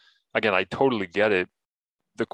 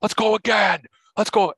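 high, loud imitation of a fired-up lineman; retold screaming; 12 semitones higher, 5 dB louder than usual. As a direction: yell it like a player psyching himself up. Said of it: "started screaming".

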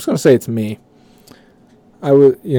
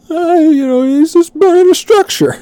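very high, breezy mock of an unbothered boss; mocking the casual boss; 6 dB louder than usual. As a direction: lampoon the boss brushing it off. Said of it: "our fucking boss was like".

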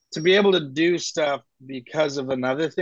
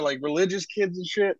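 ordinary delivery

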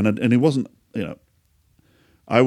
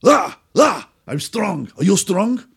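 higher, louder mock Eastern European bravado; drunk Eastern European boast; 9 semitones higher, 4 dB louder than usual. Drunk neighbour boasting to the narrator that they are strong, in a hammed-up Eastern European accent. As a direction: ham up a drunk bodybuilder.

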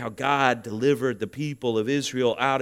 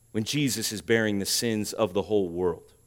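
ordinary talk

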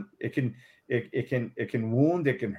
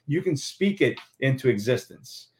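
ordinary delivery

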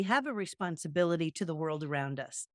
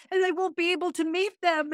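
put-on crying toddler; 9 semitones higher than usual. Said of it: "she's crying and she says".

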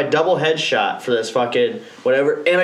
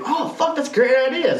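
sudden idea; 9 semitones higher than usual. Retold or imitated. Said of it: "and I was like".

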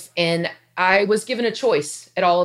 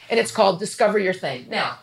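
ordinary talk, nothing marked

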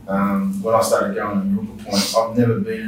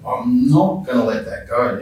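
slow, louder, NOT leaned on hard; retold inner resolve; 3 semitones higher, 5 dB louder, slower than usual. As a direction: dig in firmly on "not". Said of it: "heard those words and just went".

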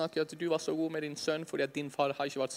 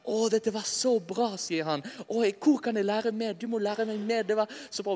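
higher-pitched, gushing imitation of a fan; imitated delight; 5 semitones higher than usual. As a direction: gush eagerly, as the thrilled man did. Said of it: "han var så begeistret og glad".

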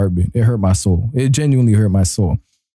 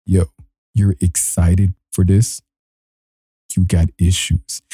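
low, slow judging voice; deadpan low-pitched scolding; mock disapproval; 5 semitones lower than usual. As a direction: drop low and slow, as if appalled by them. Mock-judging the eager listeners.

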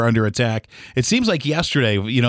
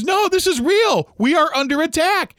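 imitating her, much higher, slow; terrified mother-in-law. Mother-in-law insisting the attack is real, imitated terror. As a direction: retell her dread up high and drawn out. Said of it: "She goes".

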